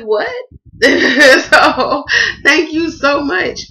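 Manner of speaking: high, loud, slow laughing thanks; overwhelmed laughing gratitude; laughing through tears. Real, not acted. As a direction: burst out laughing with relief. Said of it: "Done crying and shit".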